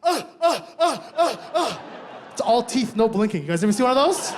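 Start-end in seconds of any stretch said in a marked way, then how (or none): 0.0-1.8 high mock staccato laugh; big African laugh. Mom bursting out laughing, high imitated laugh. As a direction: imitate mom's big toothy laugh, higher. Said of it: "this big African laugh".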